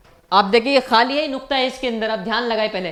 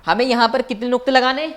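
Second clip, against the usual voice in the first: ordinary talk, nothing marked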